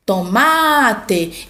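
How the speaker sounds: tomate with a long stretched MA; calling out. Shown as a demonstration. Teacher giving examples of tonic syllables, calling out. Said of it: "fica por mais tempo na minha boca".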